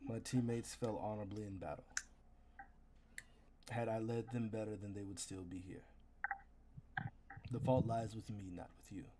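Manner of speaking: low, soft, solemn soldier taking the blame; quiet, grave apology from a noble fighter; remorseful warrior. Acted. Knight to the vampire player, low and grave.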